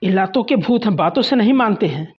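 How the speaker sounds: loud, raging yell at the wife; shouting while hitting her; furious shout. Acted. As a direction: bellow it in fury. Husband beating wife, shouting.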